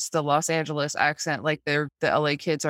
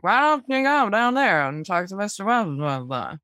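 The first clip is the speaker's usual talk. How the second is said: raised, mock yokel voice tailing off; local yokel. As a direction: imitate a small-town local, petering out into filler. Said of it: "the local yokels at the beginning".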